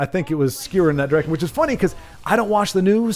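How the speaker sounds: ordinary delivery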